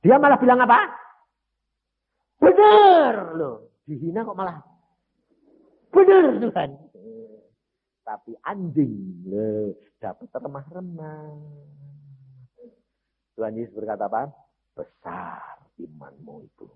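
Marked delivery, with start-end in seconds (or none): 2.4-6.7 humble agreement. Acted. 14.8-16.7 tender praise. Acted. Jesus praising her faith.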